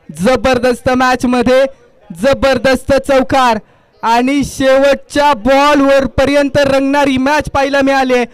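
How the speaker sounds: ordinary talk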